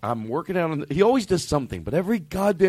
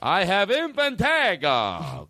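higher, drawn-out proclamation, falling at the end; grand announcement; 6 semitones higher, much slower than usual. Pretend. Host repeats it for reverb, high grand announcement. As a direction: ring it out high and slow.

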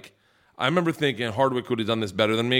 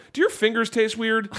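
high, silly mock-innocent question; goofy question; 9 semitones higher than usual. Bowler asking friends mid-game, in a high goofy voice. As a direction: play it as a weird innocent friend.

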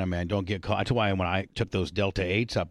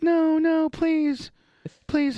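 high pleading imitation; begging; much slower than usual. Imitating his girlfriend begging him to stop, high and pleading. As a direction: beg in a high mock-terrified voice. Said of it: "She's like".

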